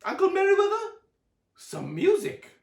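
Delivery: high, loud call; Aunt Summer calls to the uncle, loud high call. A narrator's reading. Aunt calling for music.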